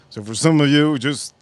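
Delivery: ordinary talk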